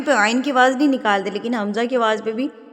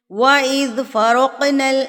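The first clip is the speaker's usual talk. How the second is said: Quran recitation; 4 semitones higher, 5 dB louder than usual. Acted.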